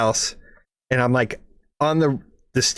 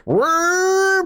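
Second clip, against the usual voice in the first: high revving whir; high-pitched motor whine; engine whir. Retold or imitated. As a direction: mimic the engine screaming up. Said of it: "And it was like".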